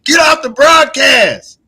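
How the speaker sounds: loud, high get off the broadcast; yelling at heckler. Real, not acted. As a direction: shout them off the stream. Kicking heckler off stream.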